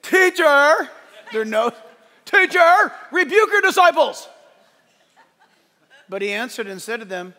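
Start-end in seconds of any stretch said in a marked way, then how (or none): 0.0-0.9 high, loud mocking call; hammed-up Pharisee heckle; disliked Pharisee's voice. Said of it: "Like this". 2.2-4.3 high, loud, hammed-up scolding; a mocking impression of an indignant Pharisee; disliked Pharisee's voice. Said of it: "I have to say it that way".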